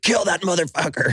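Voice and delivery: high, laughing mock order; hammed-up zealot command; outraged churchman. Church reacting to heliocentrism.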